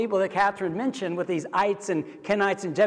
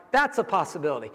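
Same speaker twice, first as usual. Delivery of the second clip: usual speaking voice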